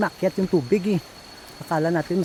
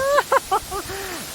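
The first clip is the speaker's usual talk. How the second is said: high laugh. Real, not acted. Laughs after cold splash.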